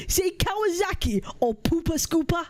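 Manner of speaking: attempted Geordie accent; demonstrating a Geordie accent. Shown as a demonstration. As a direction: put on a comic Geordie voice. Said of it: "That's my Geordie accent".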